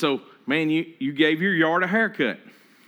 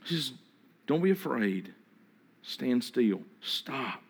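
much softer, gentle words to rest; soft calm command; 7 semitones lower, 8 dB quieter, slower than usual. Real, not acted. Preacher calming the listener, soft and low. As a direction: hush them into stillness.

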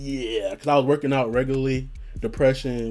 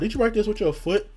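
higher-pitched mocking question; playful teasing; 9 semitones higher than usual. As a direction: tease with mock disbelief. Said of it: "I was just joking".

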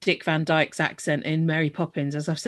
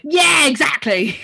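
drawn-out, louder, higher "yes!" moment; retold excited agreement; 6 semitones higher, 7 dB louder than usual. Podcaster recalling a note on a favourite bit, excited agreement. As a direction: relive an emphatic burst of agreement.

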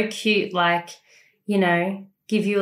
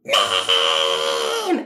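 long, drawn-out cry for mum; imitated child's scream. Child calling mum at night, imitated scream. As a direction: stretch it out like a screaming toddler. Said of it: "then she screams".

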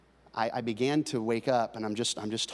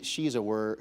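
ordinary talk, nothing marked

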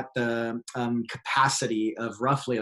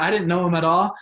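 ordinary talk

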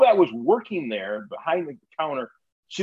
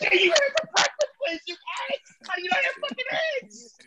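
much higher, laughing, fast outrage; incredulous rant. Retold or imitated. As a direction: shout it in mock disbelief.

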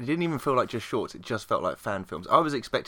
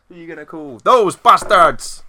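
hammed-up jingle yell; mock theme song shout; 6 semitones higher, 12 dB louder than usual. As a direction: belt out the last line, slow and much louder. Ghostbusters theme parody shout.

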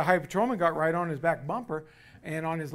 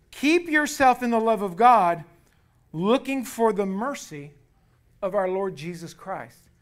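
slow, raised scripture reading; preaching scripture; 5 dB louder, slower than usual. Acted. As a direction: read the verse with weight. Rereads the verse.

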